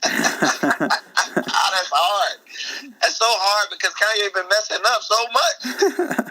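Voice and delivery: high-pitched amused agonizing; laughing protest. Real, not acted. Guest asked [name] or [name], laughing and torn. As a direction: groan through laughter at an impossible choice.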